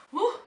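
short, high startled "woo"; sudden high whoop of surprise. Real, not acted. Vlogger fumbling a slippery shower jelly, startled whoop. Jelly wobbles and gets dropped.

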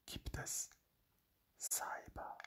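very soft, voiceless whisper. Real, not acted. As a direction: whisper the answer like a secret. Hushed answer to own question.

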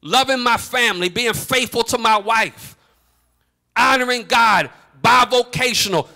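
mounting sermon intensity; preach. Real, not acted. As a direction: pour out the complaint to God in full preaching voice. Preaching at full force.

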